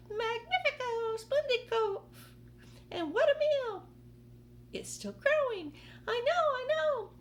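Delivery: very high, loud excited squeals; gleeful aunts. Acted. The greedy aunts raving over the peach, high excited squeals. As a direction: rave about the peach.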